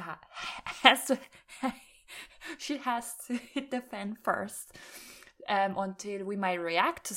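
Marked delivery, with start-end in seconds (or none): 0.0-4.8 real laughter breaking up the words; fail to get the line out for laughing; giggling restart, pitch jumping up; cracking up. Real, not acted. Cracking up at own joke.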